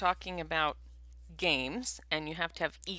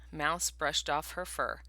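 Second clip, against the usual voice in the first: ordinary delivery, nothing marked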